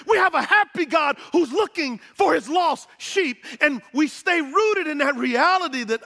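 loud, high, fast joyful preaching; joyful sermon build; preaching at full force. Acted. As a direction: proclaim it with rising joy.

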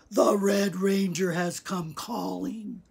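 put-on wheezy old man's voice; wheezing old man. A narrator's reading. Old man in bed announcing the boy's arrival, wheezy. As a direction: announce the visitor through a wheeze. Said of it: "he wheezed".

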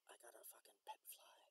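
hushed secret whisper; telling his bro about the pet fly, whispered. Real, not acted. Confides to bro.

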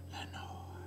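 breathless whisper, no voice at all; whispered name. Acted. Narrator alone in the dark, whispering the lost name. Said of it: "the whispered word".

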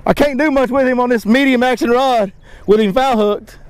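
ordinary delivery

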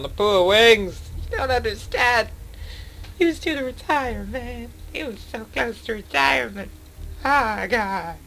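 high hammed-up wailing lament; mock grief. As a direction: ham up mourning a lost pilot. Mock mourning the lost pilot.

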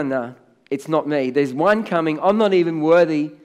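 ordinary delivery

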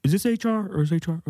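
a lightly voiced Bane, mostly his own voice; a half-hearted Bane impression, underplayed. Comic host doing a weekly Bane impression for his co-host, lightly voiced. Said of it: "That was my Bane impression of the week".

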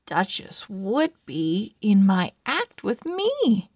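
plain reading voice

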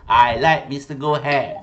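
loud, emphatic prompt; feed the class their line loudly; playful model answer. Shown as a demonstration. Jokingly models the answer he wants.

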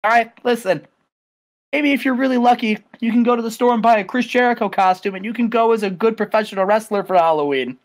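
mock him with a smug grin; cocky Jericho. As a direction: talk down to him like a joke. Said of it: "He's like".

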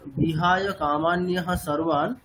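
বিহায় কামান্যহা সর্বান read slowly in one breath; recited as one unit. Shown as a demonstration. Teacher showing which part is read as one. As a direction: read the whole quarter-verse together. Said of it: "আমরা কোন অংশটুকু পাঠ করছি?".

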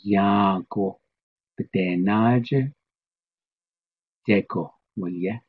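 "naje" drawn out, "deko, waliye" brisker; slow Navajo statement. Shown as a demonstration. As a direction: say the reversed sentence slowly. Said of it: "The other way".

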